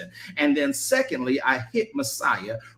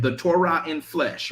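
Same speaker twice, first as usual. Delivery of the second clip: ordinary talk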